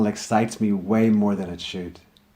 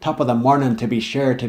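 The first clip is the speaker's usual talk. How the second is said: put-on louder stage-Irish accent, fading by the end; mock tourist Irish accent; 3 semitones higher, 5 dB louder than usual. Poet imitates tourists shouting a stage-Irish accent. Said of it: "cause tourists to shout".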